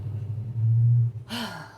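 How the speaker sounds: long low exhale of relief; big tired sigh. Shown as a demonstration. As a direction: sigh it out like end of shift. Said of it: "we all let out that big sigh".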